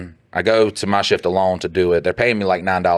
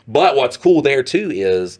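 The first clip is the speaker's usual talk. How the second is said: ordinary delivery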